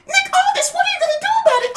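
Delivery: very high, loud shrieking voice; imitating a shrieking outraged wrestler; shrieking outrage. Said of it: "Chelsea sees".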